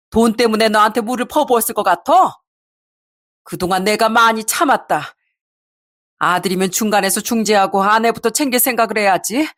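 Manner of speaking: understated angry mother's scolding; mildly voiced outrage at a son-in-law. A narrator's reading. Mother to son-in-law who made daughter cry, mildly furious. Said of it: "엄청난 분노로 소리를 치셨어요".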